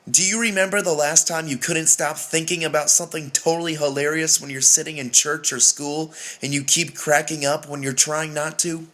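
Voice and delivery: normal talking voice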